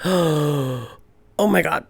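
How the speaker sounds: slow, breathless "oh my God" after a gasp; stunned gasp. Retold or imitated. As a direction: caught speechless, barely getting it out.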